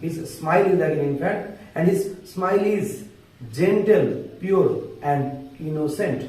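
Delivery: slow, word-by-word English with long pauses; speaking slowly. Shown as a demonstration. Teacher reading poem lines to students, slow and deliberate. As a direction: spell it out slowly so students can follow. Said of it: "আস্তে আস্তে বলছি".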